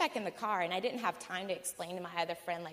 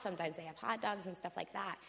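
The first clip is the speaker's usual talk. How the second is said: usual speaking voice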